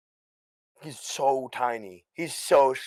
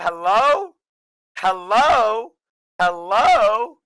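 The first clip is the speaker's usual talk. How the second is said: loud rising hello, higher and louder; calling out; 9 semitones higher, 7 dB louder, much slower than usual. Acted. Guest calling out hello to a noisy stream chat, loud and high. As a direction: call hello to an unruly crowd.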